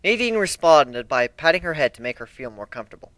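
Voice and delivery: plain reading voice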